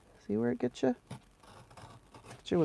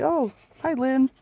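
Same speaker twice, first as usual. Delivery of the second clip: high, louder cheery hello; bright greeting; 7 semitones higher, 5 dB louder than usual. Retold or imitated. Imitating her own cheery greeting to Lynn. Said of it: "I'll say".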